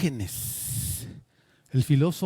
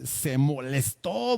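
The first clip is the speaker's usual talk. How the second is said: ordinary delivery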